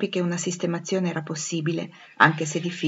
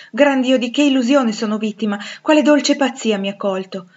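higher, louder amazed exclamation; incredulous outcry; 5 semitones higher, 6 dB louder than usual. Acted. Rochester fearing the voice is an illusion, incredulous outcry. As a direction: cry out in disbelief. Doubts his senses on hearing her.

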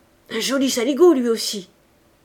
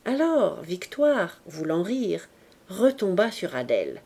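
plain reading voice

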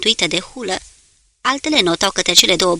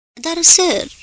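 urgent protest; 10 semitones higher, 6 dB louder than usual. A narrator's reading. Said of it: "spuse Devreux".

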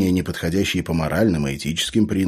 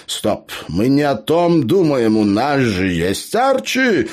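slow, high, triumphant exclamation; 8 semitones higher, 5 dB louder, slower than usual. A narrator's reading. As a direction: announce the brainwave in a drawn-out victory cry. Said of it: "победным голосом воскликнул Абер".

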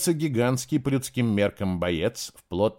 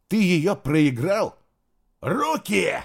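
angry; 7 semitones higher, 4 dB louder, slower than usual. A narrator's reading. Angry quarrel with guard.

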